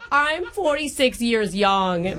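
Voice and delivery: put-on gushing, drawn-out mash-up mom accent; hammed-up Long Island-Minnesota accent; horrible jumbled accent. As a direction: gush to a boyfriend's mother in a bad regional accent jumble. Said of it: "doing a horrible accent".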